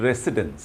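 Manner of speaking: "রেসিডেন্স" with an s in the middle; wrong pronunciation. Shown as a demonstration. Teacher shows learners' habit, mispronunciation. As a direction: say "রেসিডেন্স" as "RE-si-dens". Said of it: "আমরা বলি এটাকে".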